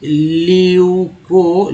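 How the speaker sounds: ल्यूको very slowly, both vowels held long; drawn-out pronunciation. Shown as a demonstration. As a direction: stretch ल्यूको into ल्यूऊ-कोओ. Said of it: "उच्चारण".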